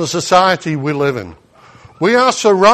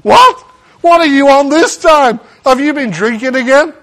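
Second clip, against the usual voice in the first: high, loud mocking disbelief; incredulous scoff; 6 semitones higher, 5 dB louder than usual. Family scoffing at his claim that Jesus will change him. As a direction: scoff at the news.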